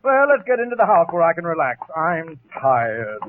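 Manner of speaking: usual speaking voice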